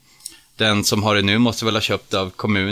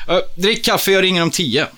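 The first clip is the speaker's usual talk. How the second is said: Erik voiced; 10 semitones higher, 5 dB louder than usual. A narrator's reading. Erik voiced, brisk on phone.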